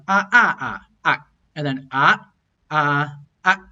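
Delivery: Taiwanese tones voiced one by one on ah; Taiwanese tone demo. Shown as a demonstration. Teacher showing students how Taiwanese tones sound. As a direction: step through each tone on ah. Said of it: "or how they sound".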